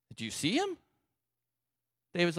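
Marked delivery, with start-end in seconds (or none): 0.1-0.8 high-pitched, softened disbelief; incredulous. Acted. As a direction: ask it in a squeak. Acted soldiers pointing out the giant.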